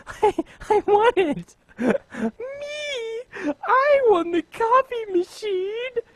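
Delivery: high, squeaky giddy delight; giddy squeal. Retold or imitated. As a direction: squeal like a kid who just won. Excited about winning the coffee maker.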